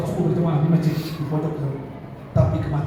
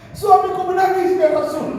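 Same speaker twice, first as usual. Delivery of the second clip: high, wailing report; distressed widow; 13 semitones higher than usual. Acted. Wife tells the Prophet of her weeping husband, distressed. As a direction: pitch it high, falling away in grief.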